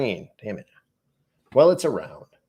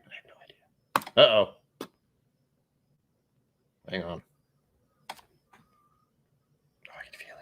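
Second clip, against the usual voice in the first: whisper; 3 semitones lower, 3 dB quieter than usual. Real, not acted. Whispered asides.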